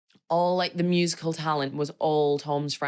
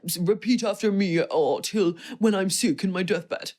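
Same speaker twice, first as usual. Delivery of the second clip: louder, solemn mock priest; mock officiant; 3 semitones higher, 3 dB louder than usual. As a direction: parody a pompous ceremony. Mocking stuffy wedding officiant.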